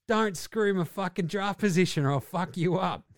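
ordinary talk

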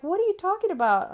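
high, fast baffled question; retold disbelief. Quoting herself to the clerk, high fast disbelief. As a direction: throw it back in disbelief.

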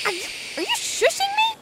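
high fast outraged question; indignant. Acted. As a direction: squeak it out in disbelief. Indignant at being shushed.

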